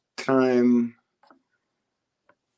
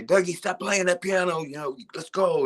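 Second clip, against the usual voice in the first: high-pitched mock scolding; imitated scolding relative; 9 semitones higher than usual. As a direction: imitate a grown-up calling a kid off the piano, voice pitched way up. Voices relatives scolding him as child.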